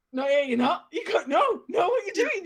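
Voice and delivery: hammed-up panic as a friend says she'll be sick; alarmed shout. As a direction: shout no, don't you dare. Panicked protest in retold scene.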